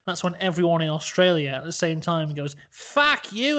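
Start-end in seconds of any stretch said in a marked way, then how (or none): 2.7-3.6 mock Aussie yell. Whole country yelling.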